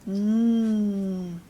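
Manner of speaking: N said as a long humming nnn; letter sound demo. Shown as a demonstration. Teacher sounds out N for children as a long hum. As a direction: hum N steadily. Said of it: "And this one is".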